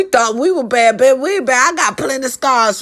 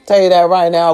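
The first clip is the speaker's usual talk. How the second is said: normal talking voice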